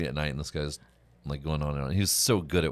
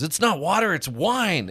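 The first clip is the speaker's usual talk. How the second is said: put-on high, loud little girl announcing it; loud squeaky reveal; 14 semitones higher, 7 dB louder, slower than usual. Little girl tells the whole theater it's wine, high and loud. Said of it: "she goes".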